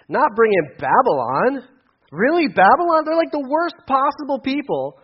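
high, loud exasperated protest; complaining. Retold or imitated. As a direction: whine to God in disbelief. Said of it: "he complains to God".

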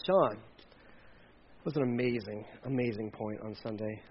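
low, hushed mock praise; imitated admiring compliment. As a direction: murmur it like an awed churchgoer.

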